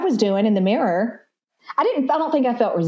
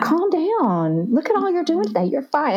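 higher, tender self-talk like a Southern mama; soothing mama voice; 8 semitones higher than usual. Retold or imitated. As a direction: reassure yourself the way a mama would. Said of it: "like a mama has come".

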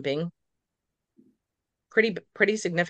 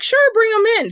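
high, loud, cheery imitation of a vet; breezy agreement; 9 dB louder than usual. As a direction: sing out a carefree yes. Imitates vet's breezy reply.

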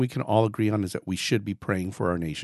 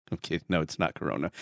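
ordinary talk

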